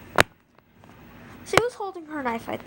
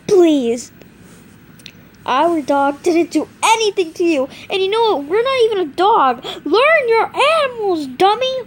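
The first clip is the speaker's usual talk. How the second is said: loud, higher, sarcastic rant; mock her for the wrong animal; loud high sassy scolding; loud mocking plea; 6 semitones higher, 9 dB louder than usual. Pleading then mocking.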